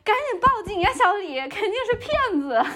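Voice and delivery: high-pitched urgent exclamation; imitated alarmed landlord. Landlord urging tenant to call police, imitated alarm.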